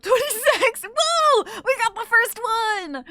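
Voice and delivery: high, loud celebratory whoop; triumphant shout to the room; excited cheering. Real, not acted. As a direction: whoop it up, drawing out the victory. Cheering first stream report.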